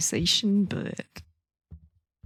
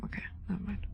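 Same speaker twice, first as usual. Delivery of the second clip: much softer breathy whisper; 10 dB quieter, much faster than usual. Real, not acted. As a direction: drop to a near silent whisper. Whispered aside while playing.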